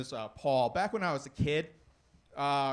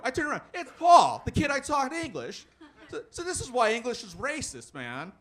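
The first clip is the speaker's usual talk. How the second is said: ordinary talk, nothing marked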